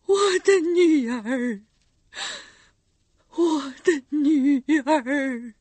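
slow, loud sobbing call; crying out. Acted. Mother at the window bars, sobbing loud cry. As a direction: wail her name through the bars. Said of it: "把脸孔凑到窗栏上喊道".

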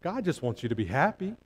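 soft, sneering repeat of the lie; mocking echo. Preacher quietly mocking the lie to his flock. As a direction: say it softly as a lie you see through. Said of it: "absolutely ridiculous".

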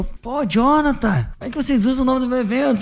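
retold grievance, pitched high; indignant complaint.

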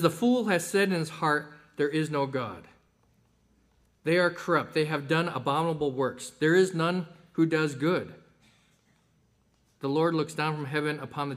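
loud, measured Bible reading; scripture reading. Acted. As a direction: proclaim the verses to the congregation. Reads scripture aloud.

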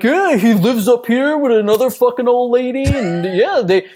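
mock the fairy-tale telling of Santa with a laugh; mock Santa storyteller. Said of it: "I will not be like".

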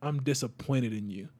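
low, quiet disappointed father; disappointed dad. Retold or imitated. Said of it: "my dad looked at me and was just like".

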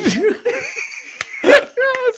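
a burst of high, loud laughter; cracking up hard at the scene. Real, not acted. Laughing out loud as his sister spoils the healing.

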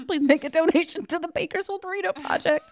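high-pitched, giggly sign-off; fighting back laughter. Real, not acted. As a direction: try to read the plug while still cracking up. Still giggling while reading the sign-off.

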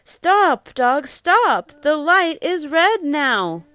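high, loud storybook command; urgent calling. Acted. Parent warns storybook dogs at the light, urgent high call.

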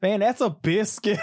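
high, loud imitation American voice; hammed-up American impression; mock American accent. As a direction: exaggerate an American, laughing through it. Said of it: "His, his impression was so spot on".

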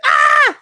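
high loud mock shriek of disgust; mock scream. Imitating the mom shrieking at a creepy painting.

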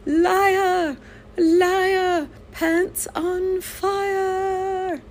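very high, drawn-out villain cry; hammed-up cartoon yell. Mad scientist yelling in a retold cartoon, high hammed-up cry. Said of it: "and he yells".

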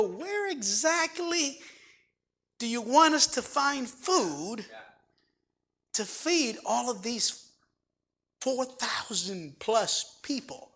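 lightly played puzzled disciple; mock disciple. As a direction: drawl it out as a baffled disciple. Pastor voices doubting disciples.

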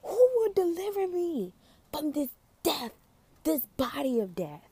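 anguished Paul. Retold or imitated. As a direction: cry it out in despair. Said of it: "He's like".

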